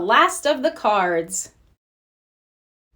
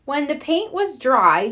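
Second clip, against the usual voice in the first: ordinary delivery, nothing marked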